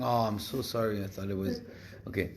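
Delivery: normal talking voice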